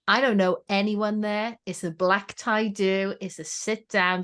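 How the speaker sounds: ordinary talk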